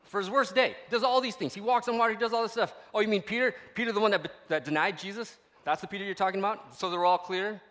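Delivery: high, loud, driving sermon voice; loud preacher voice over a mocking crowd quote; preaching at full force. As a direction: preach it out to the whole room. Preaching hard about Peter's legacy.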